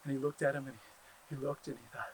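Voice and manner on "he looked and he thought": usual speaking voice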